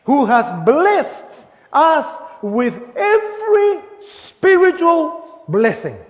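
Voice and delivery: slow, with every word stretched; slow, weighty reading. Shown as a demonstration. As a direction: lean on every and spiritual, drawn out. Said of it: "Let me say this very slowly".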